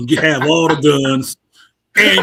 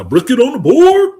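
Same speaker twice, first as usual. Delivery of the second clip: loud catchphrase; 9 semitones higher, 8 dB louder than usual. Real, not acted. Catchphrase called out.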